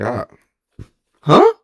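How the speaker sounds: a sudden very high, loud huh; stunned huh. Retold or imitated. Hearing his body is shutting down, stunned high huh. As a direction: disbelief jumping way up. Told organs failing, stunned.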